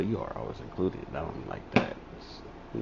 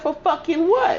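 very high and loud; the friend firing back loudly; defiant snap back; 9 dB louder than usual. Retold or imitated. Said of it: "She said".

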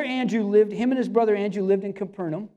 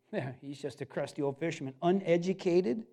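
mocking dismissal; 6 semitones lower, 8 dB quieter than usual. Mocking what people labeled Peter.

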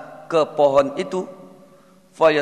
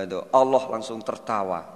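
ordinary delivery

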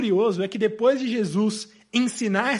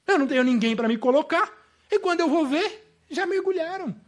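higher-pitched, exasperated grumbling rant; rude, aggrieved complaint; 4 semitones higher than usual. Acted. Preacher as the paralytic blaming the selfish crowd, exasperated. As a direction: complain bitterly that everyone leaves you behind. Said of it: "eu teria sido mais mal educado".

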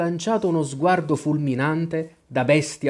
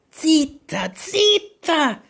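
very high, urgent hushing; a panicky, squeaky shush; hushed and agitated; 14 semitones higher, 3 dB louder, much slower than usual. A narrator's reading. Rabbit hushes the girl, agitated and anxious. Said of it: "con tono basso e concitato".

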